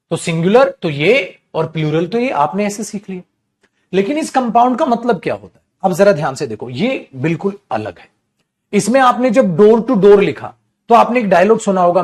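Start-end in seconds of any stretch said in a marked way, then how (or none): none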